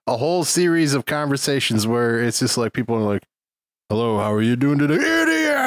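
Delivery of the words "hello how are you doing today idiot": imitated vocal modulation. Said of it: "the vocal modulation".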